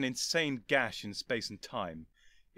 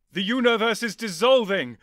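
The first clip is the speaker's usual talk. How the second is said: high, loud alarmed shout; desperate cry; 10 semitones higher, 13 dB louder than usual. Acted. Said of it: "the Doctor cried desperately".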